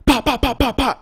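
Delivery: rapid loud, high "pop" bursts; sharp volley of vocal gunfire; imitated gunshots. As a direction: mimic a gun being emptied. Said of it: "and unloads on it".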